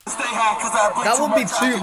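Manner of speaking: chuckling through the words, slowly; laughing. Real, not acted. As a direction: laugh as you say it. Laughing while speculating on charges.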